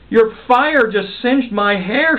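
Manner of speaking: high slow indignant shout. Acted. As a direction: yell out, singed and offended. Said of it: "shouted Pearl".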